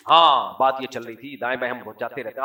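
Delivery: ordinary delivery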